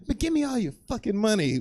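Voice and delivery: high hammed-up robber voice; mock robber. Robber with a gun demanding money, mock high voice. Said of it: "someone rolled up with a gun and be like".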